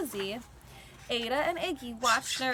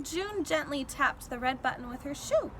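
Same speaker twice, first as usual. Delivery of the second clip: ordinary delivery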